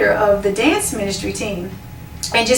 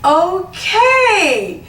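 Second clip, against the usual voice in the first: much higher, drawn-out okay; bemused okay; 8 semitones higher, 3 dB louder than usual. Retold or imitated. Woman taking in the leader's praise, much higher bemused okay. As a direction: let it rise high, unsure what to say.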